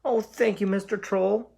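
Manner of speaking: high, grateful goat voice; relieved little goat. Acted. Middle goat thanking the troll, high and relieved.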